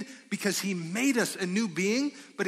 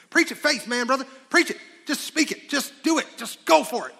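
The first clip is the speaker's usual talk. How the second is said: higher, louder cheering on, like an eager churchgoer; mock hype cheer; 7 semitones higher, 6 dB louder than usual. Preacher mimicking eager listeners cheering on faith. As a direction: pump up the crowd in mock excitement.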